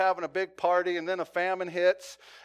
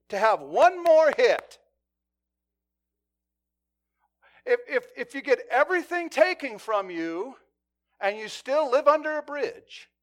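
loud, slow, hammered-out preaching climax; fiery preaching; 3 semitones higher, 6 dB louder than usual. Acted. Pastor pleading with his church about repentance, fiery preaching. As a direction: drive each phrase home to the congregation.

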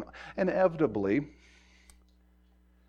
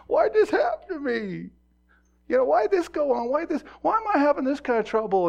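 much higher, a self-pitying whine to the Lord; whining complaint; 13 semitones higher, 3 dB louder than usual. Retold or imitated. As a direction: complain to God like a wounded child. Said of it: "Mark Morton looks around".

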